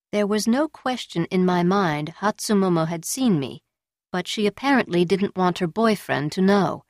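plain reading voice

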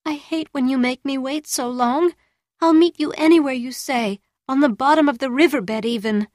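high begging whine; pleading whining voice. A narrator's reading. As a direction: plead with him, almost whining. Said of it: "in a pleading, almost whining voice".

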